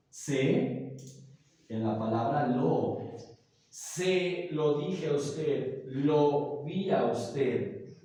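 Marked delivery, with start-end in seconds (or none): 3.7-8.0 slow, deliberate example phrases. Shown as a demonstration. Teacher demonstrates pronoun use, slowly. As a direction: lay each word out for students. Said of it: "puede estar expresado en la palabra".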